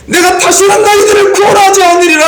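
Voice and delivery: high, loud thundering refusal; angry God shouting. Acted. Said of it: "하나님이 이렇게 막 화를 내요". (크게 외치는 화난 하나님 목소리)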